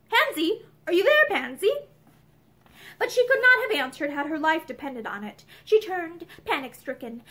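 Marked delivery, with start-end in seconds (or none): 0.1-1.7 raised, higher-pitched call; searching call, pitched up. A narrator's reading. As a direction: call for someone out of sight. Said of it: "she heard him call to her".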